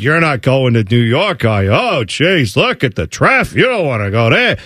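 hammed-up New Jersey grumbler; thick mock New Jersey accent, swooping vowels. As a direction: scoff like a city-hating Jersey local. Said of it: "my horrible New Jersey accent".

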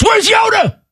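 high, shouted mock fan cry. Mocking cohost shouting for Yoda at the movies. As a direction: yell it like an eager moviegoer. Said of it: "T-Bone's too cool for school".

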